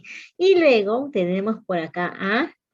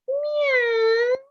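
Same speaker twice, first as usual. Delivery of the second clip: put-on reading to a toddler. Shown as a demonstration. Teacher to toddlers, high.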